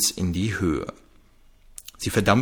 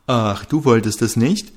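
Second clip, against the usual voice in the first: mocking imitation; 5 dB louder than usual. A narrator's reading. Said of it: "äffte ihn Trixie nach".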